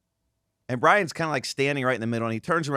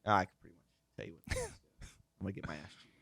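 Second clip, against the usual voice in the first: resigned mutter; 3 semitones lower, 12 dB quieter, much faster than usual. Retold or imitated.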